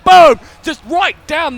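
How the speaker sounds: sudden loud, high shout of boom; a caster's big shout as the tank blows up; explosive call. Acted. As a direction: slam the word like the explosion. Caster calls Bastion explosion.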